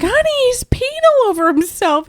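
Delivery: high, loud urgent call; alarmed call. Retold or imitated. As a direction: call out in alarm to your husband. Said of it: "I was like".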